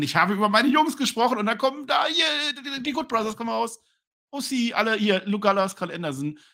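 high, loud rush of excitement; excited retelling. Retold or imitated. Host retells the wrestlers' surprise entrance, high excited shout. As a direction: build it up like a surprise reveal.

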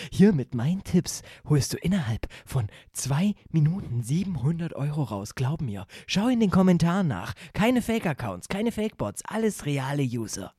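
pushy online ad pitch; ad huckster voice. Pretend. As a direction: sell it like a scammy Facebook ad. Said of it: "so eine Werbung machen".

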